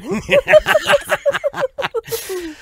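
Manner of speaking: high manic cackle; Joker laugh. Acted. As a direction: cackle like a madman. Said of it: "I would play a very good Joker".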